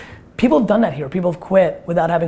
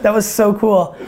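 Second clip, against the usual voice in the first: laughing; 3 semitones higher, 3 dB louder, much slower than usual. Real, not acted. Reacts to something dripping.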